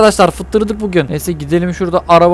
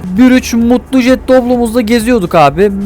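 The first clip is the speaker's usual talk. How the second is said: normal talking voice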